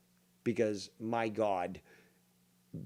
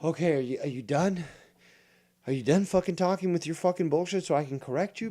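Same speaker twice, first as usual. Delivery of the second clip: fed up, pitched up and insistent; exasperated; 6 semitones higher, 3 dB louder than usual. Acted. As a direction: cut in on a rambler, losing patience. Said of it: "it's also tiring. It's just like".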